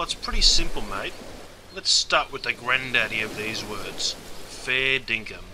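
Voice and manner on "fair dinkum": slow model phrase. Shown as a demonstration. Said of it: "Got that?".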